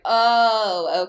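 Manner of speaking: loud, drawn-out oh of recognition; sudden realization. Retold or imitated. Podcaster acting out remembering the show, sudden realization. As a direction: let the memory land with a big "oh". Acts out sudden memory returning.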